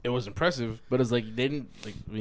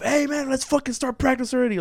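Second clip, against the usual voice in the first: high-pitched impatient coach's call; 11 semitones higher than usual. Retold or imitated. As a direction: imitate a coach hurrying players into practice. Acting the coach hurrying players along.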